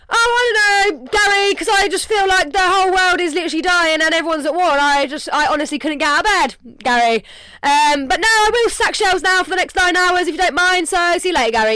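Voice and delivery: loud, high, sarcastic sing-song; sarcastic worker reply. Pretend. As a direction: sass the boss with fake politeness.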